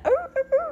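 high, wavering mimicked call; coyote call imitation. Recalling the call heard at the fire, coyote imitation. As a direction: imitate a distant coyote yip.

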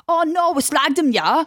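put-on high-pitched trashy Geordie girl; mock Geordie accent. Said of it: "Oh, yeah, the Geordie".

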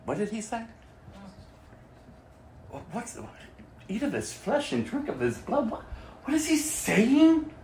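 hushed, puzzled questioning; bewildered murmur. Acted. Disciples asking each other what Jesus meant, bewildered murmur.

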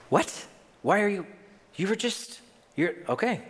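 high, halting mock disbelief; baffled. Preacher acts out listener thrown by divorce teaching, baffled. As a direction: stammer in protest, then give up.

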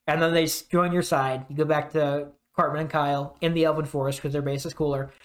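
ordinary delivery

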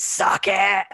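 loud possessed demon growl; demonic voice. Retold or imitated. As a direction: spit it out like a demon. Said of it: "saying in a demonic voice".

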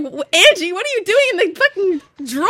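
high, loud laughing yell; laughing shout. Retold or imitated. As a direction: laugh while yelling the question. Friends shouting, laughing at elf.

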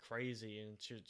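ordinary delivery, nothing marked

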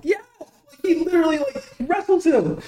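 high-pitched, talking through laughter; laughing incredulously. Real, not acted. Telling co-host about the absurd wrestling scene, laughing incredulously. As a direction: squeak it out while cracking up.

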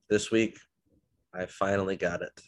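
ordinary talk, nothing marked

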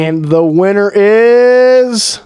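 slow drawn-out reveal, building suspense; hyped giveaway announcement. Acted. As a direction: announce the winner like a game show host.

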